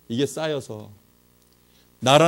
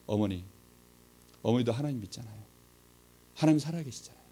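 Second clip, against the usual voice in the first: soft, low pleading with mother; earnest appeal; 7 semitones lower, 10 dB quieter, faster than usual. Retold or imitated. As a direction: reason gently and seriously with your mother. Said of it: "진지하게 말씀을 드렸어요".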